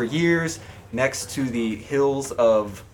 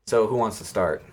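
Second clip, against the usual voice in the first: usual speaking voice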